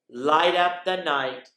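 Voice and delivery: bright, lively storybook voice; excited picture-book reading; reading to children. Acted. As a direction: read it like a party is coming.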